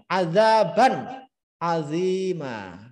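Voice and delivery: Azaban azima chanted slowly, each syllable clear; slow recitation. Shown as a demonstration. Teacher reciting the example with tanwin an, slow. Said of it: "di sini ganti an".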